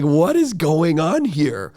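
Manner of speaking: mock astonishment at the sudden change; baffled. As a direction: drag it out in disbelief. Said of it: "I'm like".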